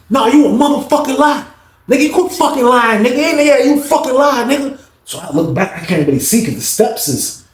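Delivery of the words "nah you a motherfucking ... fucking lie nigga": high-pitched angry accusation; voicing a furious inmate, high and heated; angry accusing yell. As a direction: yell at him like he's lying to your face. Reggie yelling accusation in story.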